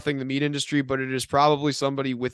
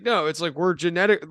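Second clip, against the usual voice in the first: normal talking voice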